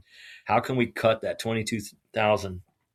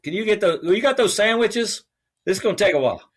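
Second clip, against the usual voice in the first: eager call for sandwiches; 12 semitones higher, 7 dB louder than usual. Retold or imitated. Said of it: "He was like".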